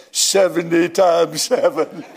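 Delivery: slow, measured answer from above; lightly voiced heavenly answer, drawn out. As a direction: deliver it slowly as a divine reply. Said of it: "a voice came back and said".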